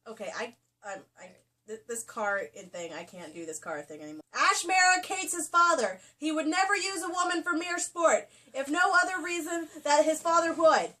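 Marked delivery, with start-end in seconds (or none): 4.3-10.9 loud, high, pompous declaration; grand proclamation. Acted. Reading Ash's noble creed aloud, pompous and loud. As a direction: proclaim it like a herald.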